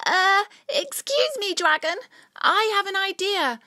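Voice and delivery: high, loud call up to the dragon; loud shout. Acted. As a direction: shout it up at her ear. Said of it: "shouts Cyril very loudly".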